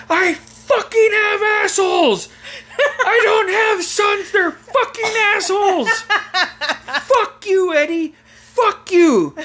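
high, drawn-out screechy rant; mock furious mother. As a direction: yell at your sons as an enraged mom. Mother rages at her sons.

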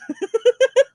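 high-pitched staccato laugh. Real, not acted. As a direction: burst of high, loud giggling at your own joke. Laughs at own Hulk joke.

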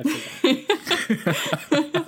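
high, slow laughing admission. Real, not acted. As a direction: giggle it out sheepishly. Caught out, laughing.

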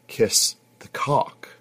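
breathy, slow giggly whisper; giggling. Acted. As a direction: giggle it out under your breath. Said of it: "she giggled".

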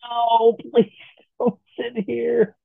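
high, slow whimpering plea; pleading cringe. Pretend. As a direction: beg them not to sit.

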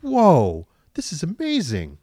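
mock-awed reaction; amazed. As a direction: gush like you just saw something incredible. Acts his own amazed reaction.

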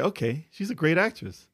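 usual speaking voice

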